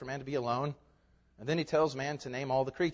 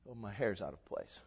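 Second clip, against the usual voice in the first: lower, softer, a touch of petty fuss; vain thought; 9 semitones lower, 10 dB quieter than usual. Retold or imitated. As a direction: mutter it like a vain churchgoer. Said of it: "and we think".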